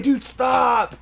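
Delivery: high, strained yell; retold protest shout. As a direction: shout it as a desperate plea to quit. Friend caught, yelling back in story.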